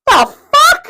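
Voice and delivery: high, loud shocked cry; shocked exclamation. Retold or imitated. Passenger shocked as the plane fishtails, loud high cry.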